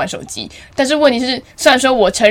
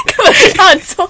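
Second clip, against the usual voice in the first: laughing protest; 10 semitones higher, 4 dB louder than usual. Real, not acted.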